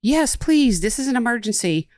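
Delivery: high, pleading caller voice; womanly caller voice. Retold or imitated. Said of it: "I'm kinda saying it in that voice".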